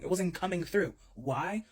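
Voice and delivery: usual speaking voice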